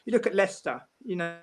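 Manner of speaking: usual speaking voice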